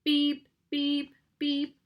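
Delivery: high, even electronic-style beeps; watch alarm beeps. A narrator's reading. Watch signals the end of silent time, imitated alarm beeps. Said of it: "Thorn's watch sounded".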